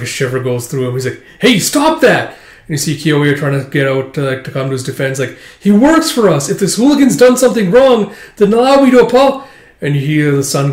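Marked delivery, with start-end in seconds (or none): 1.4-2.4 high, loud protest; acted shout. Bystander calls to stop a beating, acted shout. 5.6-9.4 high, loud acted pleading; urgent protest. She comes to his defense, urgent loud protest.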